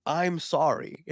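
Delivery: high, loud, exaggerated sorry; pleading apology. Seller apologising to a regretful customer, pleading. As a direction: apologise with comic desperation.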